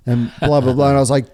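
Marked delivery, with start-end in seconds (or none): none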